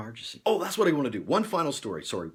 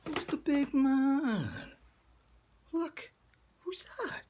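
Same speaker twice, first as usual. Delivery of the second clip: very high, drawn-out cooing to a pet; doting pet talk; slower than usual. Real, not acted. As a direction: coo at your pet in falsetto.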